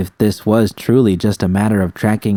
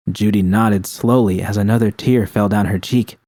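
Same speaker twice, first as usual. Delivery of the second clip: usual speaking voice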